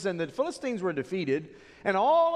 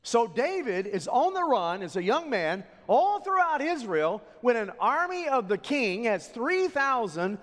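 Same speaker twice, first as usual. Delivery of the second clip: ordinary delivery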